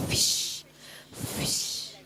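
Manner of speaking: fish with a long voiceless f hiss. Shown as a demonstration. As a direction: breathe the f of fish out as friction. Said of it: "it's friction".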